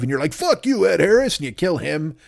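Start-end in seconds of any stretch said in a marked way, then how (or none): 0.3-1.4 mock hero retort.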